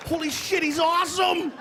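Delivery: high, awestruck exclamation; high-pitched mock amazement; amazed kids. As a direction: imitate kids blown away by a trick. Amazed kids in a retold story.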